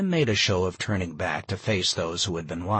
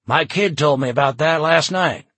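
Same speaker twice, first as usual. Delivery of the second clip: louder, higher pitch throughout; excited bystander; 6 semitones higher, 8 dB louder than usual. A narrator's reading. Bystander backs up the doomsday talk, excitedly. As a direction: pipe up eagerly over a crowd.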